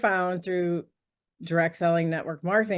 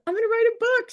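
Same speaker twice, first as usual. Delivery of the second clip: much higher, a little louder, eager; excited announcement; 3 dB louder, much faster than usual. Retold or imitated. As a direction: blurt out the news happily.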